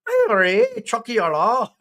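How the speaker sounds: high-pitched, hammed-up Irish cry; joking Irish accent. Streamer joking around for YouTube, mock Irish accent. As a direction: toss out a daft mock-Irish catchphrase. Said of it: "like a good Irishman".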